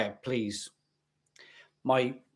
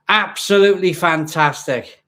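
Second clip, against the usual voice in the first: emphatic; 3 semitones higher, 8 dB louder than usual. Real, not acted. Loud emphatic repeat.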